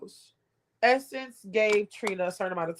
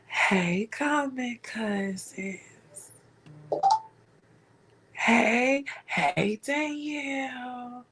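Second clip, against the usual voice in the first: mocking Essence in a slow, hushed whisper; slow, breathy mock-pleading for her friend; whispered Essence impression, drawn out; slower than usual. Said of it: "I gotta do my Essence voice".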